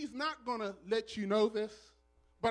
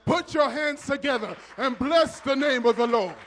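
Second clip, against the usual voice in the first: loud, higher, exultant call to praise; full-force preaching; 5 semitones higher, 13 dB louder than usual. Real, not acted. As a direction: preach it big, rousing the church to clap. Exhorts congregation to praise.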